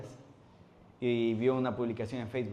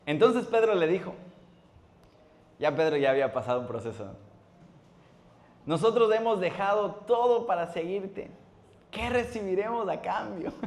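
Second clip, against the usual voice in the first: dramatic scripture reading; 7 semitones higher, 5 dB louder than usual. Acted. Pastor voicing Peter to the church, dramatic high reading. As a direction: play up Peter's bold question.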